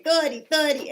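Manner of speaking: put-on "thirty" for thirsty, called out high and loud in a small child's voice; little clock calling "thirty" for a drink; little boy mispronouncing. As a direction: yell "thirty, thirty" like a toddler who can't say "thirsty". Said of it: "he couldn't say thirsty".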